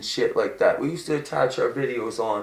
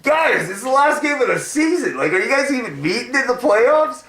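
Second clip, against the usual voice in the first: high, loud fan yelling at the game; hyped-up hockey fan hollering at players; excited yell; 13 semitones higher, 4 dB louder than usual. Retold or imitated. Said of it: "I was like".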